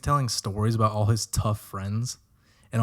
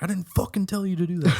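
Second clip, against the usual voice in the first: mock yell; 7 semitones higher, faster than usual. Yelling at splashing spoon.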